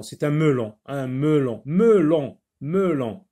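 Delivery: "melon" said over and over, one loud peak; drilling the word; repeated for listening. Shown as a demonstration. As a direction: repeat "melon" so pupils can hear for "wa". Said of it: "Est-ce que tu entends wa?".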